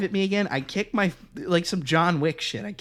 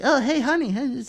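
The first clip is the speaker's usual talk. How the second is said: mock sweet husband; 9 semitones higher than usual. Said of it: "Just like".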